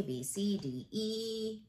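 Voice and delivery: ordinary delivery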